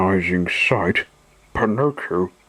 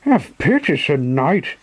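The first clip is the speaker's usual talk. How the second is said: high pleading puppet voice, much higher; supplicating voice; 6 semitones higher than usual. Acted. Pinocchio begging the showman, high pleading. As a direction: beg for mercy in a high voice. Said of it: "in a supplicating voice".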